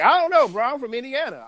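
mock a high-voiced country accent. Retelling how a stranger from Indiana answered, in a high country accent.

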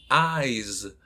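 "eyes" slow with a buzzing z at the end; voiced final z. Shown as a demonstration. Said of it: "es con el sonido con voz".

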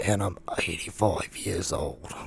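put-on soft, slow old man voice; old man spirit. Quoting a dead spirit in a little girl, old man voice. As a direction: imitate an aged ghost, quiet and drawn out. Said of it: "there's, like, an old man".

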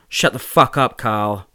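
spat angrily. Acted. Mark snapping at Carl in the park, loud and angry. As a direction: spit the words at him. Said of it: "I spat".

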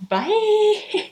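high sing-out farewell; squeaky-high sign-off; high-pitched bye. Real, not acted. Signing off in the outtakes.

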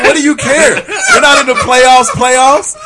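mock exasperated retort. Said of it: "I was like".

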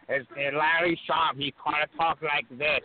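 put-on high, slow, nasal-sounding old wrestler voice; Larry Sharpe impression. Wrestling school coach imitating late trainer Larry Sharpe, high slow voice. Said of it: "See if I can do the voice".

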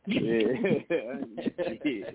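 usual speaking voice

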